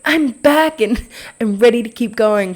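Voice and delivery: mock excited comeback. Podcaster mocking her own grand return, mock excited. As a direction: announce a big return with put-on excitement.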